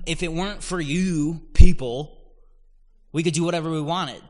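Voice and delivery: loud, high, rushed rebel complaint; rebels' mock outburst. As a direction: hurl it out like a rebel fed up with rules.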